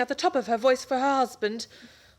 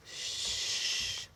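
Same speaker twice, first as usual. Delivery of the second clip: hushing; 12 dB quieter than usual. Acted. Hushing at someone coming.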